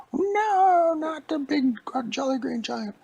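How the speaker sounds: put-on very high, loud old lady squeal; a squeaky alarmed granny. Old lady crying out at the giants, squeaky and high.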